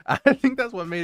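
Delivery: loud, laughing through words; amused and laughing. Real, not acted. Laughing about goofy movie.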